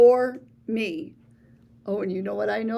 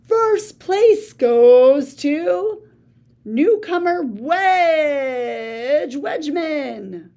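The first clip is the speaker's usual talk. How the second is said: slow, louder booming announcer; thunderous announcement; 3 semitones higher, 8 dB louder, much slower than usual. Acted. Announcer declares the winner to the crowd, slow and booming. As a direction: boom it out over the crowd. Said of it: "a thunderous voice making the dreaded announcement".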